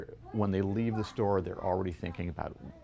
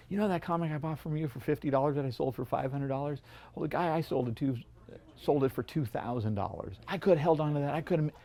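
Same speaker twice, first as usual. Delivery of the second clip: frustrated customer; 7 semitones higher than usual. Retold or imitated. Customer complaining about missed profit.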